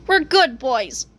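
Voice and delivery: ordinary delivery, nothing marked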